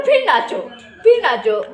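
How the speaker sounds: giggling cheer of victory; laughing tease. Real, not acted. Teasing the kid over the score.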